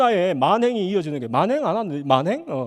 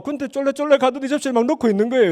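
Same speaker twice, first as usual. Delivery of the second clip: ordinary talk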